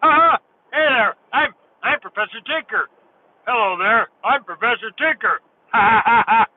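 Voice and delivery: high, hammed-up mascot voice with big ha-ha laughs; Professor Tinker. Shown as a demonstration. Introducing himself as the toy shop mascot, high-pitched hammy character voice. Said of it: "He kinda talked like this".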